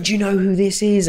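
high, excited boast; drunk lad. Retold or imitated. Drunk fan asks his missus this, high and excited. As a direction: show off the fighter like a trophy. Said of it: "he was looking at her going".

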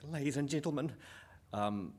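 loud, high, hammed-up New Zealand accent; terrible Kiwi accent. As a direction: announce a movie night in broad mock Kiwi. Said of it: "he does it in a Kiwi accent".

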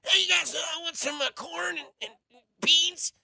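a deliberately bad, high-pitched impression of the quarterback; terrible Patrick Mahomes. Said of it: "That's a terrible Patrick Mahomes impersonation".

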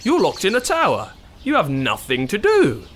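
lightly voiced puzzled prince; a hint of a sensible prince. Prince voice in story.

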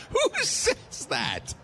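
high, slow, laughing cry of disbelief. Real, not acted. Teller to his cohost, laughing in disbelief. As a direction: shout it through laughter, can't believe it.

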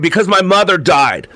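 high, angry outburst; narrated shout, pitched well up. A narrator's reading. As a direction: raise the pitch and snap it out in anguish. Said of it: "I turned to her and shouted".

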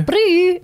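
high, loud lip-buzz squeak; squeaky shoe noise. Retold or imitated. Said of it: "My shoes went".

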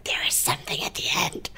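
insistent whisper, very soft; whispered retort. Retold or imitated. Viewer answering cinema staff who checked twice, whispering. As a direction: whisper it as a retold reply.

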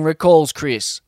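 ordinary delivery, nothing marked

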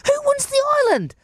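very high, insistent grandma voice; imitating nana. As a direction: nag like a stubborn nana. Nana presses again.

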